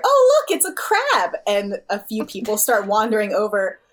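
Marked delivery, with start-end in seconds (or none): 0.0-1.3 loud, high delighted call; excited partygoer shout. Acted. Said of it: "you hear".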